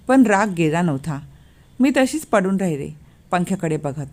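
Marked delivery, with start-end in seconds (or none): none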